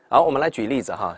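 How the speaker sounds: usual speaking voice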